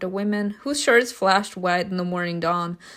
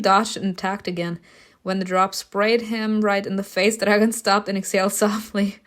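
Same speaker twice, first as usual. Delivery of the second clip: ordinary talk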